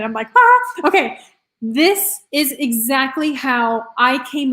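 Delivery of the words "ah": a sudden high, loud squeal of delight; excited squeal. Retold or imitated. Host reliving her excitement to viewers, high loud squeal. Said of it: "I'm just so excited".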